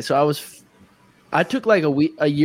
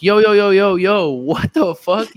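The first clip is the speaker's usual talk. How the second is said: loud, higher burst of protest; alarmed retold outburst; 6 semitones higher, 6 dB louder, slower than usual. Man protesting as a stranger steps inside, alarmed and loud. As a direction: fire off the yos, then stretch the question.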